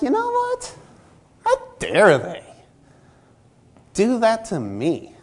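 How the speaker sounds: mimicking a churchgoer's self-pitying outrage; mock indignant. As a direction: play up wounded pride. Acted offended churchgoer.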